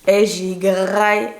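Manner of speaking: loud, long drawn-out "graille"; relishing emphasis. Real, not acted. Telling viewers how she feasted in France, relishing. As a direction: stretch "graille" out with gusto.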